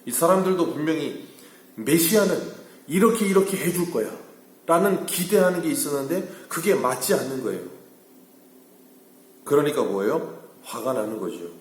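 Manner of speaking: high, loud pulpit delivery; forceful preaching. Acted. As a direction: drive the point home to the congregation. Preacher drives the point home. (목소리를 높인 힘찬 설교조)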